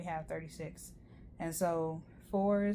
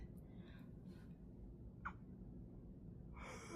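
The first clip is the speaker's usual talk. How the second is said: long open-mouthed yawn; 16 dB quieter than usual. Real, not acted. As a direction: let out a big yawn. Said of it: "sorry for yawning".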